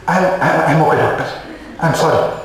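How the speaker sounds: acted holding back a tear, halting; restrained weeping. Natrum patient apologising to the doctor, restrained acted weeping. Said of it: "how beautifully natrum weeps".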